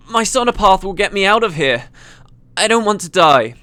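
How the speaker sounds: wavery. Acted. Stunned engineer pleading for her way out, wavery. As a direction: plead it, still dazed from the blast. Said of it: "her voice wavery with the after effects".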